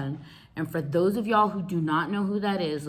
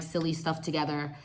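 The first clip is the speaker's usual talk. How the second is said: ordinary talk, nothing marked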